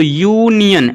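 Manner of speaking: "यूनियन" with a drawn-out opening "yoo"; teacherly, syllable-split "यूनियन", yoo-nian; pronunciation demonstration. Shown as a demonstration. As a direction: stretch the first syllable of "यूनियन". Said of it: "इसका आप pronunciation देखिए".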